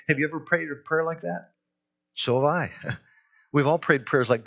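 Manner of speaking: ordinary talk, nothing marked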